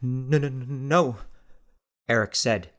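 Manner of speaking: forceful insisting between gasps; slow, trembling denial through cold; shivering. A narrator's reading. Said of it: "forceful insistences between gasps".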